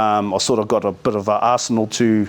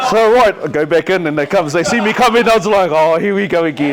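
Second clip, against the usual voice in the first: high and loud, full of glee; excited; 7 semitones higher, 5 dB louder than usual. Real, not acted. Recounting walking back into the staff meeting, excited and loud. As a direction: tell it buzzing with excitement. Lively retelling of hustling staff.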